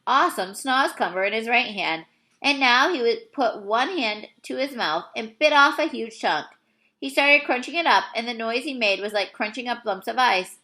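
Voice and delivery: ordinary delivery, nothing marked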